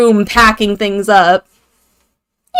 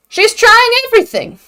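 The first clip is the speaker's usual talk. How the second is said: "She's trying everything" said again much higher, stress on "trying"; pitched-up repeat of "She's trying everything"; 10 semitones higher than usual. Shown as a demonstration. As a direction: lift "She's trying everything" up high the second time.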